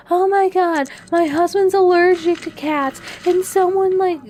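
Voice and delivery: shrill-pitched panicked woman, loud; frantic high squeal of a panicking tenant; mock hysterical tenant on the phone. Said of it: "the best interpretation of the voice".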